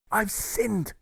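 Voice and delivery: high, anguished cry of guilt; shout. A narrator's reading. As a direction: cry out the confession, pitched high. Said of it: "he shouted".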